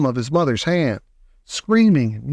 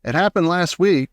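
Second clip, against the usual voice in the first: usual speaking voice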